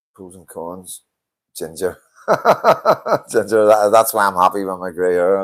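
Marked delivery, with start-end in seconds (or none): 2.3-3.2 high, loud sudden laugh; real laugh; burst of laughter. Real, not acted. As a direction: crack up at the joke. Laughs at ginger remark.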